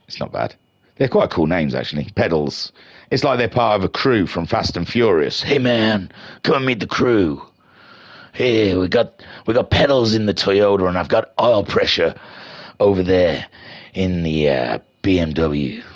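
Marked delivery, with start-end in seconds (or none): 5.4-15.8 Vin Diesel. Retold or imitated. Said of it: "That was my Vin Diesel, uh, uh, impression".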